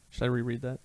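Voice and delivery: ordinary delivery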